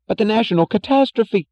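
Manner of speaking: high, loud, frantic man pouring out bad news; panicked Jim. A narrator's reading. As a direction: babble it in rising, angry alarm. Jim's last frantic protest.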